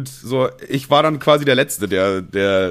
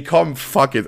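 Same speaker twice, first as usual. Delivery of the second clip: normal talking voice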